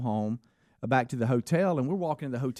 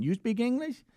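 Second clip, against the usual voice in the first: hopeful asking; 6 semitones higher than usual. Retold or imitated. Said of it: "Is that how you say it every time?".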